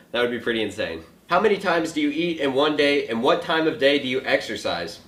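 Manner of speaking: ordinary talk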